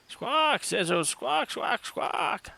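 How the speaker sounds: shrill-high squawking calls; high bird squawks. Acted. Sezu squawks in alarm.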